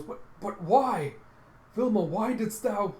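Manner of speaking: high, loud, dismayed questioning; hammed-up cry of betrayal; acted shocked plea. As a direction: plead in stunned disbelief. Betrayed character pleads with attacker.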